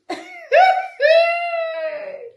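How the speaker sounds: shrieking burst of laughter; a high cackling laugh. Real, not acted. Laughing hard at the Prime Minister's joke.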